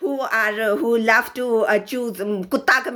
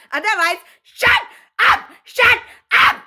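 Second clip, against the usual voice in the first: yelled much higher and louder; angry shout; 10 semitones higher, 5 dB louder than usual. Real, not acted. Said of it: "I got a little angry".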